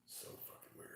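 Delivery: hushed breathy whisper. Real, not acted. Unvoiced whisper listening.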